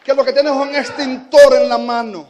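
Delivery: loud, high fervent exhortation; preaching at full force. Acted. Preacher urging the church to keep crying out. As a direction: urge them on with fire.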